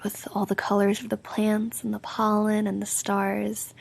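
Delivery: ordinary delivery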